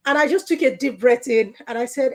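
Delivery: usual speaking voice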